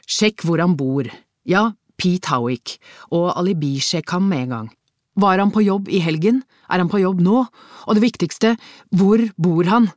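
building from firm to loud, high urgent questions; urgent phone instructions. A narrator's reading. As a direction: fire off orders, pressing harder each time. Detective barks orders into mobile phone. (bestemte ordrer, stadig høyere og mer pågående)

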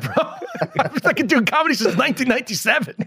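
high, laughing mock retort to commenters. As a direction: toss it back with a laugh.

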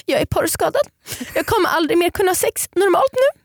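high, hammed-up self-pity; mock despair, high and whiny; dramatic sulk. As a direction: overplay the tragedy like a sulking child. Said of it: "varför jag blev så konstig".

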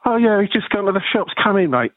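imitated London accent, pitched high. Young punk welcoming a visitor at the door, imitated London accent. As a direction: mimic a chirpy London mate in a high voice. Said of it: "he had a really London accent".

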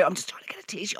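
whispered apology to the queue. Retold or imitated. Murmuring to bystanders in the coffee queue, whispered. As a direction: breathe it out, barely audible.

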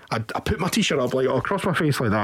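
normal talking voice

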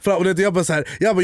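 usual speaking voice

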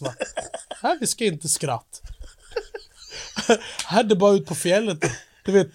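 hammed-up northern Swedish dialect; norrländska. Grumbling Norrlander making a friend laugh, in broad norrländska. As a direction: do a comic Norrland accent. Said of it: "Kan du prata norrländska då?".

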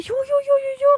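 high, whiny pleading. Retold or imitated. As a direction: beg in a high, strained voice. Pleading to escape.

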